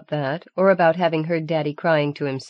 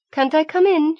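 put-on much higher, louder childish plea; pleading; 12 semitones higher, 5 dB louder than usual. A narrator's reading. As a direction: beg to be let in. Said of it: "she pleaded".

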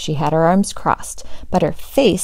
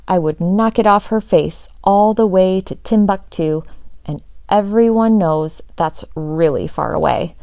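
ordinary delivery

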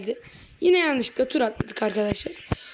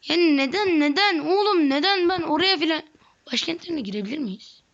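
frustrated rant; 5 semitones higher than usual. Real, not acted. Ranting at game AI.

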